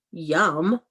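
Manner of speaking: ordinary delivery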